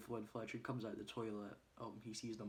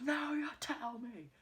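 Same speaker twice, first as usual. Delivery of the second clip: very high, loud, drawn-out exclamation; hammed-up exasperation, stretched out high; exasperated outburst; 14 semitones higher, 6 dB louder, slower than usual. [name] to Ernie on late news, exasperated.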